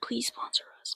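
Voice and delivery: unvoiced hushed plea; whisper. Real, not acted. Begging brands to sponsor, joking whisper. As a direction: sneak it in as a secret.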